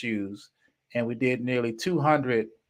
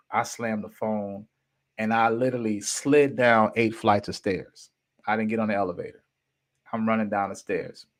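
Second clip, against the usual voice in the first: plain reading voice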